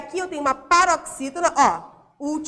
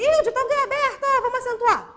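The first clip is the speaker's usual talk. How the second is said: much higher, eager exclamation; mock excitement; 9 semitones higher, 3 dB louder than usual. Teacher mimicking a hasty rule-follower, mock excited. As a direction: pitch it way up like an overkeen pupil. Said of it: "Não é só porque eu digo".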